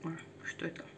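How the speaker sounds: ordinary talk, nothing marked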